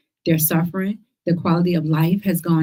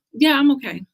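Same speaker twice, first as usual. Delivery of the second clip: high-pitched, pointed reassurance; restrained sarcasm; 7 semitones higher, 4 dB louder than usual. Retold or imitated. Survivor fending off pity, restrained sarcasm. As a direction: brush off the concern, pitched up and barely civil. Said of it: "I try not to be sarcastic".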